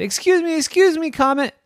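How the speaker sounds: high, loud, urgent hail; calling out. Acted. Pudding flags down a passing comet, urgent hail. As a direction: call out to catch someone flying past.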